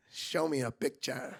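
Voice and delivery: low, hushed request; gossipy sister act. Retold or imitated. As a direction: drop it soft and low, one of the girls. Acting one of the girls.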